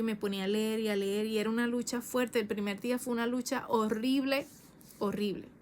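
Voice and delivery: ordinary talk, nothing marked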